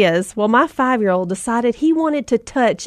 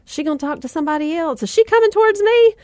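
nervous disbelief; 6 semitones higher, faster than usual. Retold or imitated. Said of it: "I looked behind me like".

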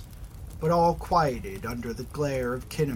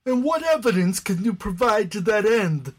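higher, louder, demanding question; 8 semitones higher, 6 dB louder than usual. Acted. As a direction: demand proof sharply. Skeptic demands proof.

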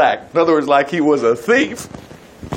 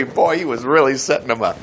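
ordinary talk, nothing marked

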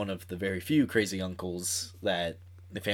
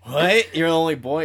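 surprised; 7 semitones higher, 10 dB louder than usual. Retold or imitated. Friends react to five sisters.

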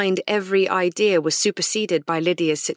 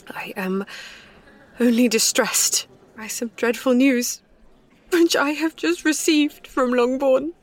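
higher, breaking with distress; near tears; 5 semitones higher than usual. A narrator's reading. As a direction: voice giving way to tears. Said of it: "She burst into tears as she alluded to it".

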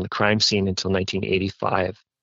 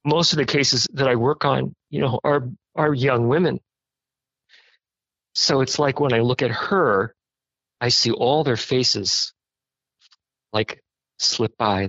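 tearful; 4 semitones higher than usual. Real, not acted. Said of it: "I'm crying".

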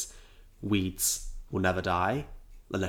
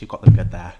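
loud; 15 dB louder than usual. Acted. Loud far remark.

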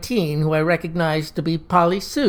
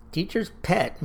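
softer, muttered aside; muttered under his breath; 7 dB quieter than usual. A narrator's reading. Davis mutters at the eager classmate, softer. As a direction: grumble it under your breath. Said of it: "muttered Davis under his breath".

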